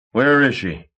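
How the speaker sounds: WHERE barked at the agent; captain demanding his missing boat; angry demand. A narrator's reading. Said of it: "Marsh demanded".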